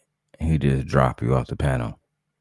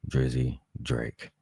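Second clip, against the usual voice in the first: usual speaking voice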